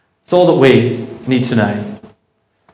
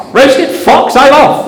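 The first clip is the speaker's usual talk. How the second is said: much higher, louder, frightened fox; panicked fox; 12 semitones higher, 4 dB louder, slower than usual. Acted. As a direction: yelp it out as the fox bolts. Scared fox flees in story.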